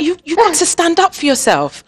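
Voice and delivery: rally her, half pleading; insistent pep talk. Retold or imitated. Mother insisting her shy daughter stand up for herself, emphatic.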